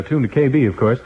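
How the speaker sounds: ordinary talk, nothing marked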